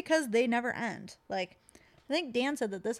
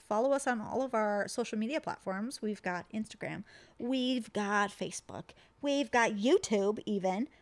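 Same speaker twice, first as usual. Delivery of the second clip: ordinary delivery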